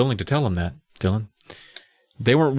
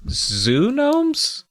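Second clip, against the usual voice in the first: surprised; 12 semitones higher than usual. Acted. Squinting, confused question.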